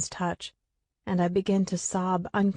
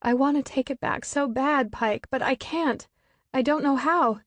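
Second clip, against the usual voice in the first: tearful pleading; 6 semitones higher than usual. A narrator's reading. Sobbing apology to dead brother.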